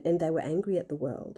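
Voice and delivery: ordinary talk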